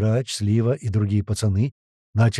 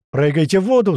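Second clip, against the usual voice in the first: loud, high shout; yelled command; 6 semitones higher, 6 dB louder than usual. A narrator's reading. Someone tells men on platforms to jump, loud yell. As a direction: yell it urgently, pitched up. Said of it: "заорал им кто-то".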